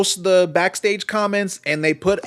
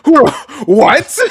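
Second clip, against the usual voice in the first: imitating Chris; 6 semitones higher, 9 dB louder than usual. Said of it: "Chris looks at me like".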